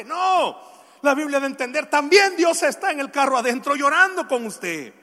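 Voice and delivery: high, loud pulpit voice; fervent preacher in full flow; impassioned preaching. Real, not acted. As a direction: challenge the congregation forcefully. Preaches with force.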